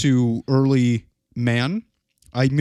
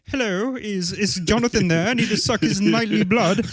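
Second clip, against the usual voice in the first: lightly voiced polite vampire; 8 semitones higher than usual. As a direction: knock and ask like a courteous vampire. Vampire calling at the door.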